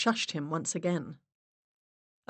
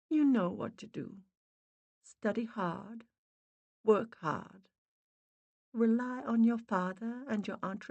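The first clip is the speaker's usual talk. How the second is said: soft, higher-pitched motherly voice; faint mother spirit; 5 semitones higher, 4 dB quieter than usual. A narrator's reading. Dead mother advising her daughter, faint gentle voice. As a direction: advise gently from far away.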